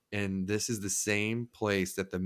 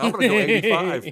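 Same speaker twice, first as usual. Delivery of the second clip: higher, louder mock taunt; cocky boast; 10 semitones higher, 9 dB louder than usual. Driver retelling taunting the cop, cocky and loud. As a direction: brag it teasingly, hammed up. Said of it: "And I was like".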